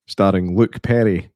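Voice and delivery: ordinary talk, nothing marked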